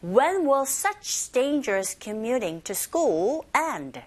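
slow sentence read for learners to repeat; very slow teacher model of the question. Shown as a demonstration. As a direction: stretch the sentence out clearly. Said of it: "One more time".

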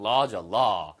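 "law" low-pitched with a long open vowel. Shown as a demonstration. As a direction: model "law" like lah, no oh glide. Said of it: "이 발음도 주의하세요".

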